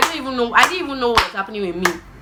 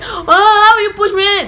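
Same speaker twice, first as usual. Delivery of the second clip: much higher, louder, slow whiny protest; little sister wailing at her brother; indignant child; 11 semitones higher, 5 dB louder, slower than usual. Retold or imitated. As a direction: wail it like a wronged little girl. Little girl pushed by brother.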